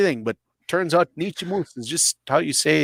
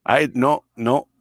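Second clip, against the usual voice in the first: ordinary talk, nothing marked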